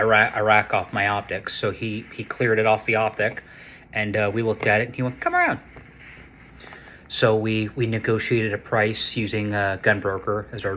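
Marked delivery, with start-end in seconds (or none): 5.2-5.6 high-pitched retold quote. Friend's retold reply at the store.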